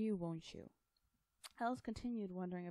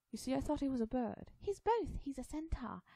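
high, loud storybook chatter; excited character voice; 7 semitones higher, 4 dB louder than usual. Acted. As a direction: pipe up high, peaking on the second thought.

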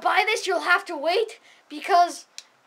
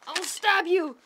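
ordinary delivery

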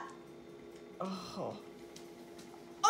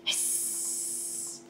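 snake hiss; 8 dB quieter than usual. Acted. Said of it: "This is snake".

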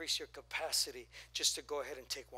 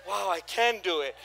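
excited; 3 semitones higher, 9 dB louder than usual. Retold or imitated. Amazed while lifting.

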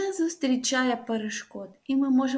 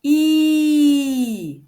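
held, loud smiling I; loud drawn-out I sound; long loud I; 4 semitones higher, 10 dB louder than usual. Shown as a demonstration. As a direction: stretch out a loud ee for the class. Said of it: "Повторюйте за мною".

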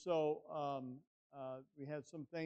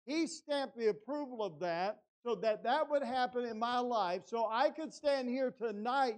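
raised, high-pitched pulpit voice; impassioned preaching; 7 semitones higher, 8 dB louder than usual. Real, not acted. Preacher testifying that God is good in adversity, loud and impassioned. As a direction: drive the conviction home to the congregation. Preaches the lesson of his loss.

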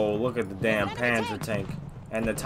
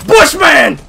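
high shouted name; spotting the enemy, shouting; alarmed shout; 16 dB louder than usual. Real, not acted. Spots feared enemy.

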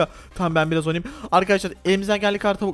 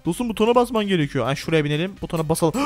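ordinary delivery

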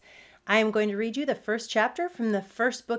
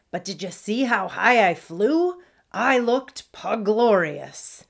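loud, showy pug bragging; a hint of a preening little pirate; boasting; 4 semitones higher, 8 dB louder than usual. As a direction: brag proudly, stretching the last words. Fancy pug boasts about her flight.

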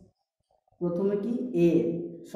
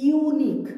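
"Unique" said much higher and louder on "ইউ"; stressing the yoo; 9 semitones higher, 6 dB louder, much slower than usual. Shown as a demonstration. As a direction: hit the "ইউ" of "unique" high. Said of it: "ইউ বলছি". ("Unique" এর "ইউ" উঁচু স্বরে জোর দিয়ে)

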